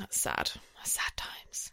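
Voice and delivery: soft unvoiced whisper. Real, not acted. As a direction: breathe it out as a mock sad aside. Mock mourning, whispered aside.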